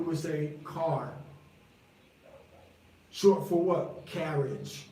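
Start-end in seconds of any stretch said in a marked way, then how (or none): 0.6-1.1 CAR said properly, slow and careful. Shown as a demonstration. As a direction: pronounce car slowly and correctly. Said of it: "gonna say the word properly".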